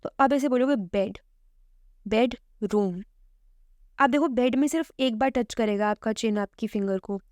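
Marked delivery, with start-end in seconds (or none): 2.1-3.0 बेडरूम in two separate beats, बेड, रूम; split into syllables. Shown as a demonstration. Teacher showing the chin-tap test, बेडरूम in two syllables. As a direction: leave a gap after बेड in बेडरूम. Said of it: "बेडरूम में दो बार touch किया".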